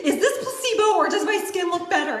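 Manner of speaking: high, loud and quick; bubbling over at the mirror; asking her husband, giddy and loud; excited quote. Retold or imitated. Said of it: "I'm like".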